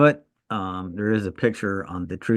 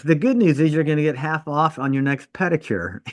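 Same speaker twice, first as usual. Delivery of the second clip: laughing punchline; 7 semitones higher than usual. Real, not acted. Joking punchline to a patient.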